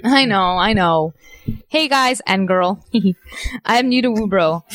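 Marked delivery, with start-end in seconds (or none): 1.7-4.6 slow, deliberate reading; reading aloud haltingly. Shown as a demonstration. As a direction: read it out slowly, word by word. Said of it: "read it slowly".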